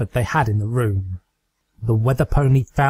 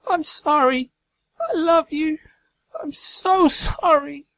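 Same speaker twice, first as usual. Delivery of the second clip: tearful goodbye. Acted. Rainbow Dash apologizes to Fluttershy, grief-stricken.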